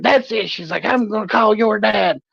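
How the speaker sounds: mother threatening to call the father. Retold or imitated. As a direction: threaten it like an angry mom.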